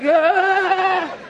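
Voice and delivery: high, drawn-out "ah"; hammed-up high wail; mock pained cry. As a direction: cry out as if head is squeezed. Acting squeezed head.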